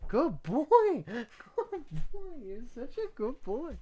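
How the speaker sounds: very high, soft cooing praise; cooing at the dog that just did a trick. Real, not acted. Praising his dog, laughing.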